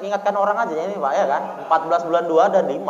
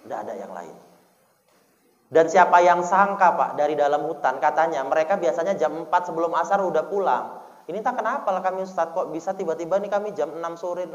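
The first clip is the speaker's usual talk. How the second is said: ordinary talk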